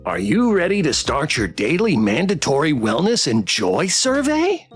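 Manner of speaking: sing-song toy pet, pitch jumping up at the end; perky automated questioner; cheerfully compulsory robot pet; chipper survey bot. Acted. Plush pet opens survey.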